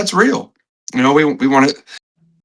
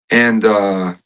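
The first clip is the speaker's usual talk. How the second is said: usual speaking voice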